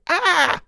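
sudden high scream of pain. Acted. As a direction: shriek as the shock hits. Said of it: "Twilight cried out before she could finish".

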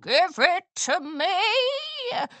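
high, slow witch demand; demanding crone. A narrator's reading. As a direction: screech it at them like a hag. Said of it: "she croaked".

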